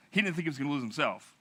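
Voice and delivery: ordinary talk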